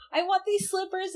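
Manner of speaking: put-on high pleading girly voice; a touch of a spoiled girl; high whiny mock Usagi. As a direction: beg for them like a spoiled kid. Usagi demanding slippers.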